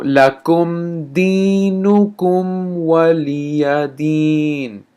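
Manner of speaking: very slow chanted recitation; teacher closes with the verse. Shown as a demonstration. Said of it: "let me say this again".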